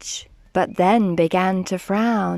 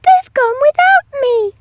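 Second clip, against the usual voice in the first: high, loud wailed complaint; hammed-up high cry; 8 dB louder than usual. A narrator's reading. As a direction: wail it like a left-out youngster. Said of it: "He cried".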